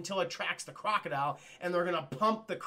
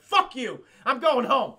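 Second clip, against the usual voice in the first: loud high defiant voice; defiant cow; 4 semitones higher, 8 dB louder, slower than usual. Retold or imitated. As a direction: storm off as the freed cow.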